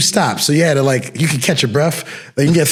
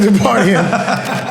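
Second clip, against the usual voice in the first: laughing, higher, mocking echo of "Partying"; 6 semitones higher than usual. Bouncer mocks the drunk guy's word back at him, laughing. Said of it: "I was like".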